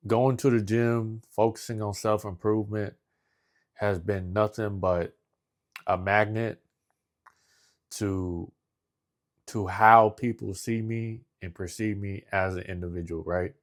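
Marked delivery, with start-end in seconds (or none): none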